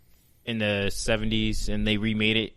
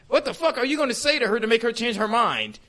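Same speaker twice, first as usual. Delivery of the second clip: usual speaking voice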